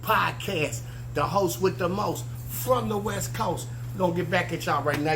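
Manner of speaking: podcast host intro. Acted. Host opening his Let Me Land podcast, slow hyped announcer voice. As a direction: announce the show like a radio host drawing the crowd in.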